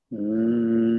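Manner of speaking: high humming sigh out; audible sigh. Shown as a demonstration. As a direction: let out a long hummed sigh. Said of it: "Exhale for seven".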